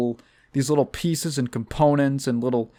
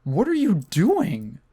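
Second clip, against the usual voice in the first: higher, indignant mock outrage; mock furious parent; 8 semitones higher than usual. Comic as a parent robbed by the kids, mock furious. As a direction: play the robbed parent, fed up. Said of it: "I would be furious".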